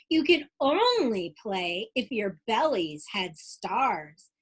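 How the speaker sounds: lively rhyming storybook read-aloud; bouncy Dr. Seuss-style read-aloud; reading to children. Acted. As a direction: read it like bedtime story time for kids. Reads picture book aloud.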